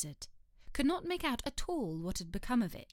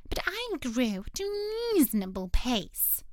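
sulky dormouse; 3 semitones higher, 4 dB louder than usual. Acted. Dormouse sulks.

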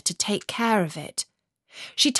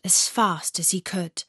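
usual speaking voice

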